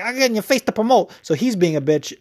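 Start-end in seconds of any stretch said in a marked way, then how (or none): 0.0-1.1 higher, quicker mock quote; mocking Paulie. Podcaster parodies Paulie's excuse in a higher, quicker voice. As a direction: parody his excuse with a sneer.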